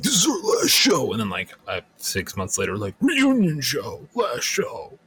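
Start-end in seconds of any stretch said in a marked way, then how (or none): 0.0-1.1 higher announcing last show. Retold or imitated. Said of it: "a lot of hardcore bands would do that". 3.0-5.0 higher, slow stage announcement; announcing voice. Retold or imitated. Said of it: "hardcore bands would do that".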